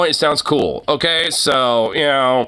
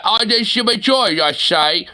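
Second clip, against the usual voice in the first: higher-pitched, garbled British parody; mock British accent; 5 semitones higher than usual. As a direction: make British English sound unintelligible. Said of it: "goddamn motherfucking British limey".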